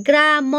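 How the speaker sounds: "গ্রাম" drawn out as two pieces, গ্রা and ম; over-split word. Shown as a demonstration. Teacher testing a further break, "গ্রাম" split as গ্রা-ম. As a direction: stretch "গ্রাম" into গ্রা, then a separate ম. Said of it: "এরকম করে হয়".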